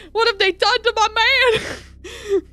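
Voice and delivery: high, slow anguished cry; grieving wail. Acted. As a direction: mourn over her man. Grief-stricken wail.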